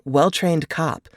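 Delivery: ordinary delivery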